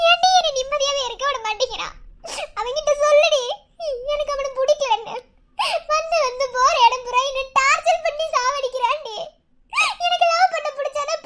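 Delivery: high, upset wail of complaint; tearful distress. Acted. Girl begging friend to stop the harassing suitor, tearful. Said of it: "சரி சரி, நீ அழாத".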